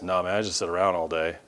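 low, soft, fast slacker reply; mock apathetic mumble; listless patient. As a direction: toss it off low and quick, can't be bothered. Acts out unhealthy patient answering doctor.

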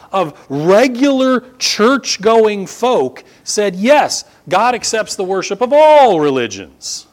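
high, loud, slow pulpit emphasis; preaching at full force. Acted. Preacher stressing the survey result to the congregation, loud and slow. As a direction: hammer home the shocking survey.

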